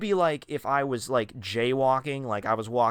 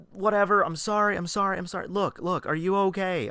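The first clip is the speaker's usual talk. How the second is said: high, hurried and flustered; flustered apology; 8 semitones higher, 3 dB louder than usual. Retold or imitated. Narrator apologizing to the angry driver, flustered. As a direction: apologize fast, pitched up.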